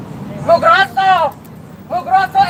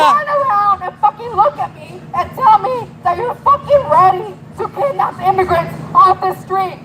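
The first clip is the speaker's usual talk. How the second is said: ordinary delivery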